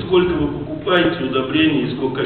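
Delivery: ordinary delivery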